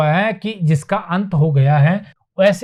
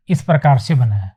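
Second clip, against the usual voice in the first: ordinary talk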